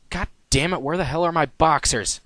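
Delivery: a hint of frantic annoyance; frustrated outburst. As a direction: curse while scrambling for clothes. Frantically gathering clothes.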